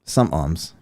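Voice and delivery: SOMETIMES as "some-imes"; dropped T. Shown as a demonstration. Host demonstrates his speech, dropping the T in SOMETIMES. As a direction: leave the T out of SOMETIMES. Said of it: "There's no T in that word".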